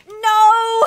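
loud, high, drawn-out no; dismayed shout. Real, not acted. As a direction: wail as it goes wrong. Something goes wrong.

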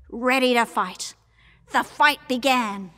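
ordinary delivery

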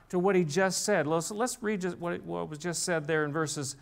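ordinary talk, nothing marked

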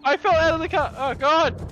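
high, loud, breathless; excited yell. Real, not acted. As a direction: shout in shocked excitement. Crash aftermath.

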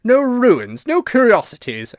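high, loud ghostly disbelief; incredulous, high-pitched outburst; indignant exclamation. A narrator's reading. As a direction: throw the words back, scandalised. Ghost retorts to Virginia's satire.

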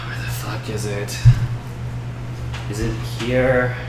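normal talking voice

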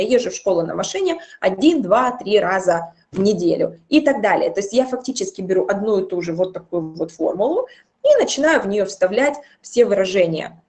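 ordinary delivery

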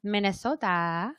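lightly put-on Minnesota accent. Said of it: "I do a Minnesota accent".

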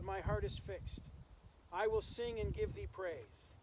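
normal talking voice

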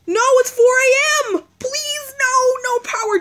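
high, panicked pleading. Real, not acted. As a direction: voice shooting up in alarm. Power running out at 4 AM.

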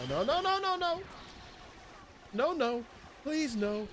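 high frantic string of no's; pleading, about to lose it; panicked no's. Real, not acted. Player about to be killed, panicked high pleading.

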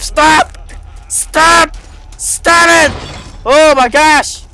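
high, insistent shouts to stop; alarmed yelling. Real, not acted. Gamer yelling at a creepy statue, alarmed shouting. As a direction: yell at it to back off.

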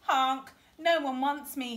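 mildly sorry-for-himself storybook duckling; sniffing sadly. A narrator's reading. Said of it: "he sniffed".

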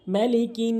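মালিকিন with quick vowels and a nasal ghunnah; tajweed demo; recitation of malikin. Shown as a demonstration. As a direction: chant মালিকিন with the n hummed. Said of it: "গুন্না করবেন".

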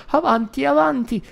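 put-on very high, loud old woman urging; frenzied high granny; urging shout. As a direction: push him on, frantic. Grandma urging on.

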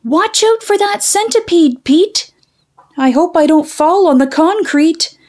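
high-pitched cartoon character voice. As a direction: play the little cartoon friend, high and bright.

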